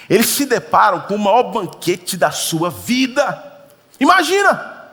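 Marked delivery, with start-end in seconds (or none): 4.0-4.9 high, loud Imagina!; excited exclamation. Acted. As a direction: burst out in wonder. Preacher exclaims to congregation.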